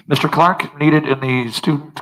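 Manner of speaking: like a principal paging over the PA; a hint of a school intercom announcement. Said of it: "that's flashbacks to middle school".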